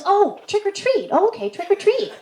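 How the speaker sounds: high-pitched aha from the homeowner; high, relieved man voice. Retold or imitated. Homeowner at the door finally catching on, high and relieved.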